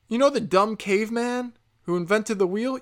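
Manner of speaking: ordinary talk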